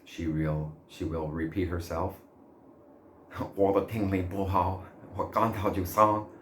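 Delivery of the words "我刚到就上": usual speaking voice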